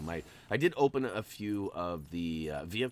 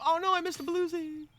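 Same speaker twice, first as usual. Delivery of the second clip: mock dismay. Mock dismay at missed stud.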